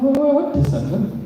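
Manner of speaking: high, sing-song baffled question; mock bewildered. Comedian acting out his puzzlement at the cop's gift, pitched high. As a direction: play it up as puzzled disbelief. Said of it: "I'm thinking".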